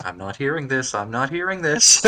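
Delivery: block it out, rising as you repeat; building denial. Acted. Player voices Wester refusing to listen, building denial. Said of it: "Wester is just kind of like".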